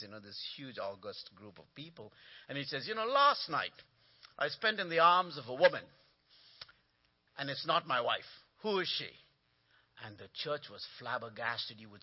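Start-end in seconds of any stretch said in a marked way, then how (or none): 2.9-9.1 joke delivery. Retold or imitated. Junior pastor copies the joke.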